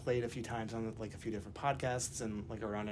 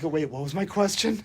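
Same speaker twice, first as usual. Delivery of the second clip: flustered; 5 semitones higher, 10 dB louder than usual. Retold or imitated. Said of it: "I'm like".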